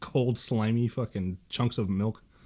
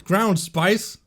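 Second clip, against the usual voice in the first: incredulous; 9 semitones higher, 8 dB louder than usual. Real, not acted. Incredulous repeat of answer.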